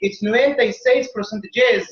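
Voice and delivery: hammed-up Italian scientist protesting; Italian accent. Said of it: "then the Italians go".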